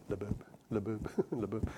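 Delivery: labab repeated softly like a heartbeat; heartbeat thump sounds. Shown as a demonstration. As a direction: say labab labab as a soft pulse. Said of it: "kinda sounds like the heartbeat".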